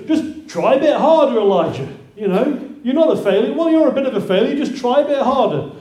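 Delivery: a mock demanding coach; mock pushy. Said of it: "if God had allowed Elijah just to carry on".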